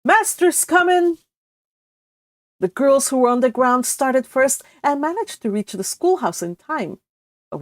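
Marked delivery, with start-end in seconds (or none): 0.0-1.2 high, loud warning call from a treetop; boy shouting a warning from up a tree. Acted. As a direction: yell the alarm to the others. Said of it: "Jimmy Glover shouting".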